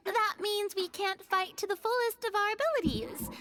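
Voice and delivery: ordinary talk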